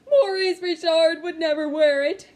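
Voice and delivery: loud, high sobbing cry; crying protest. Acted. Boy pleading with his mother, crying loudly. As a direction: wail it out, near screaming through tears. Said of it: "I was crying".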